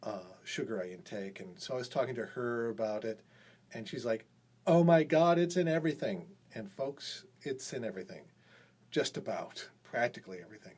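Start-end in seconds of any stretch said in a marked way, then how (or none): 4.7-6.3 louder, higher exclamation of shock; excited exclamation. Retold or imitated. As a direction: exclaim it like a stunned coworker. Coworker quoted, exclaiming again.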